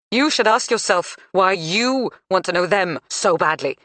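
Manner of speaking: blaming mother. A narrator's reading. Mother blaming Nell's circle.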